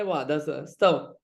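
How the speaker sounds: usual speaking voice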